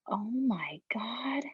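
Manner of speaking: slow, hushed retold "oh my God"; soft, drawn-out mock disbelief. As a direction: draw it out softly, as if stunned. Retold stunned reaction at low point.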